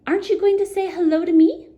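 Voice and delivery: high, fast and loud little goblin. Acted. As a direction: chirp it like a cheeky goblin. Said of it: "asked the goblin".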